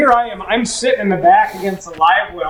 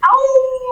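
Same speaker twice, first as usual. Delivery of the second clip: high howl; imitated howl. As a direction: howl like him.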